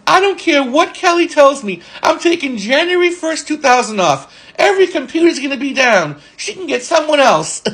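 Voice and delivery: high-pitched imitation of an indignant coworker; defiant refusal.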